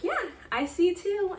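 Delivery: excited confirmation. Retold or imitated. Doctor confirming twins to shocked husband, excited. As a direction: savour the good news.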